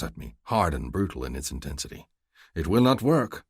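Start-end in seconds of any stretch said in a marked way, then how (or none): 2.5-3.4 louder, higher, sharp declaration; suddenly furious. Acted. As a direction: flare up in jealousy. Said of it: "I declare, suddenly furious".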